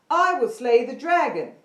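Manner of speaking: loud, ringing knight's vow; projected knight. Shown as a demonstration. Teacher demonstrates the knight projecting to the class, loud. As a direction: project it from the face like a bold knight. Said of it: "he says it from the facial area".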